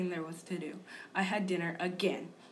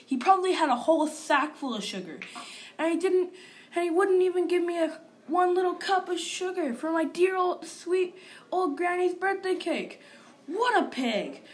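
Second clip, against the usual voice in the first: indignant; 8 semitones higher, 7 dB louder than usual. Real, not acted. Indignant wolf ranting.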